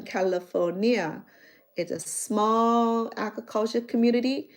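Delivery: normal talking voice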